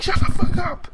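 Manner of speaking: high, loud mock outburst; angry yell. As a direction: yell it like an exasperated film character. Acted yell from film scene.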